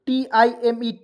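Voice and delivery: ordinary talk, nothing marked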